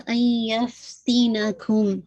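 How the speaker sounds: ان یفتینکم very slow, ن half merged into ی with nasal ghunna; slow tajweed recitation; partial merging. Shown as a demonstration. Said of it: "لیکن ادغام ناقص ہے".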